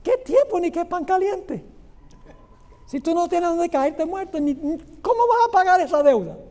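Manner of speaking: scornful refusal. Acted. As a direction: scoff at the debtor's excuse. Señor rechaza la súplica con burla. (burla desdeñosa del amo, en tono más agudo)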